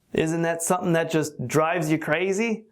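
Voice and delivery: ordinary delivery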